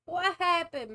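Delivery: high, loud and delighted; delighted disbelief; excited laugh. Real, not acted. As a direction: burst out amused at the song. Excited reaction to the song.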